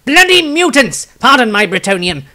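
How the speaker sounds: sudden high shout at the mutants. A narrator's reading. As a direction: yell it out, then a quick apology. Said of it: "Tobias shouted".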